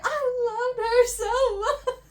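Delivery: a high crying wail. Retold or imitated. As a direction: sob the words out. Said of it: "Crying so hard".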